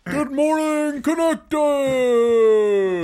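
loud, high greeting with a long held last word; good-morning shout. Acted. Host copies the bit for the intro, hammed-up shout. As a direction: belt out a big hammed-up show opener. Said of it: "Do that, do that".

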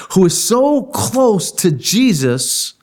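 usual speaking voice

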